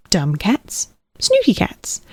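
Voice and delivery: usual speaking voice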